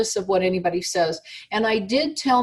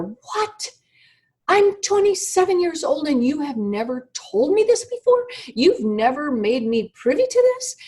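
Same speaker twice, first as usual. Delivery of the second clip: angry yell; 7 semitones higher than usual. Retold or imitated. Grown daughter yelling at her mother for keeping secrets, high and angry. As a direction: relive blowing up at mom in disbelief. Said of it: "I screamed at her".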